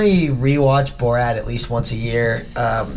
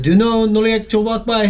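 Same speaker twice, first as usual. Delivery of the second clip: put-on Borat accent; 7 semitones higher than usual. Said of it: "he's like".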